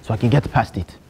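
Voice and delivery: put-on lightly held Nigerian accent, earnest student; a Nigerian student pleading with the teacher. To a geography teacher, a mild Nigerian accent. Persona in security class.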